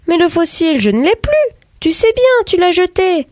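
put-on very high, anxious child's protest; alarmed. A narrator's reading. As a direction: remind your father in rising alarm. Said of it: "en alerte". (voix aiguë, affolée)

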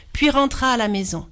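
plain reading voice

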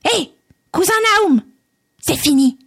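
very high, squeaky little-man cry; tiny man crying out. Acted. Tiny bearded man dismissing the table, high squeaky cry. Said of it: "Puis il cria".

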